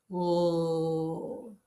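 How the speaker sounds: sustained, isolated "L"; L sound. Shown as a demonstration. Teacher shows class where the tongue goes, held L sound. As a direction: hold "L" with tongue tip behind the top teeth. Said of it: "when you say the L sound".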